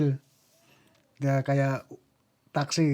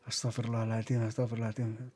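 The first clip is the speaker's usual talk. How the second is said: softer, lower murmured plea for forgiveness; hushed prayer; 4 semitones lower, 8 dB quieter, faster than usual. Retold or imitated. Dreamer facing death's door, praying in a low hush. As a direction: repeat it under your breath, low.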